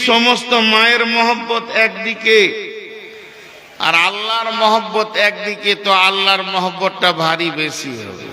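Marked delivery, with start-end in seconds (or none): none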